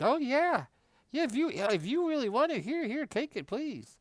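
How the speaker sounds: put-on very high sweet old-lady voice. Old woman offering her appetizer to the speaker, very high sweet voice. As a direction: hand it over in a high, sweet granny voice. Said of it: "It's like".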